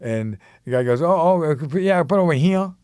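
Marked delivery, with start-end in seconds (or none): 1.0-2.7 higher-pitched imitation of a Mississippi local; "over here" slurred into Mississippi "ova hee-uh"; Mississippi accent. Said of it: "my first thing of going to Mississippi".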